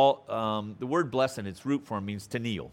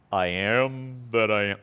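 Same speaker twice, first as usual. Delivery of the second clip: Ten Commandments voice; 3 semitones lower than usual. Retold or imitated. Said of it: "You gotta say it with that kind of voice".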